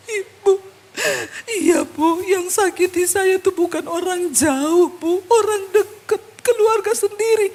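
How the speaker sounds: high, slow tearful wail, acted out; hammed-up weepy confession, words dragged out; imitated crying. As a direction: mimic a woman sobbing out her hurt.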